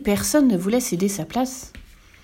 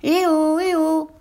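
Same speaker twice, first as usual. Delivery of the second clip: calling out; 6 semitones higher than usual. A narrator's reading. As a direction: call up to a bird overhead. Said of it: "cria-t-elle".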